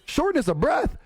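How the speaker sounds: mock-incredulous rant, voice jumping up high on the question; Cat Williams rant. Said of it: "He was like".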